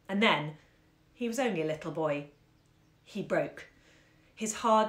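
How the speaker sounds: ordinary delivery, nothing marked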